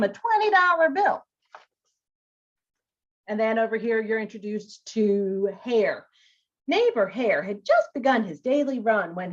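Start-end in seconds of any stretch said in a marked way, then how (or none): none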